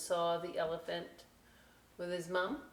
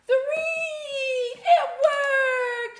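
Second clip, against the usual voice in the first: much higher and louder, cheering with a laugh; excited cheer; 12 dB louder, much slower than usual. Real, not acted. Host cheering to kids when the toy parachute works, loud and high. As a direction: shout it out in delight as it lands. Craft parachute drop succeeds.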